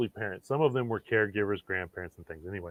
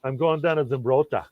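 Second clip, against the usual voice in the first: put-on a mild, self-doubting stab at a Minnesota accent, a bit louder at the start; a hint of a Fargo-style Minnesota accent; 3 semitones higher, 6 dB louder than usual. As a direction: lightly play a Minnesota local heading to the hemp maze. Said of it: "I don't know if I can do the, the Minnesota dialect very well".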